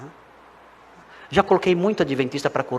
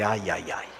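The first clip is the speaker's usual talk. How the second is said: mock dread; 4 semitones lower, slower than usual. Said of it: "É hoje que eu vou tomar um couro".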